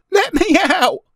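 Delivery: put-on very high, loud child's cry; frantic high yell. A narrator's reading. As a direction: shout it like a panicking boy. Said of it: "he cried".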